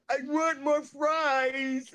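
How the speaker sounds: high, very drawn-out whine; Roger impression. Homeless man demanding food from the lady, high drawn-out whine. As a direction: wail the demand, stretching the last word. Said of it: "he was like".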